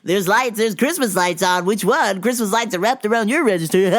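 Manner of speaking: put-on high, loud nagging old man; mocking customer. Old customer pointing at the Christmas lights, high and loud mock. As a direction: whine it like the pushy customer. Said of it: "He goes".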